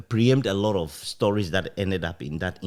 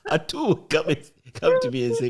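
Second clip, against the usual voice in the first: laughing; 3 dB louder, faster than usual. Real, not acted. Retelling the office joke, laughing.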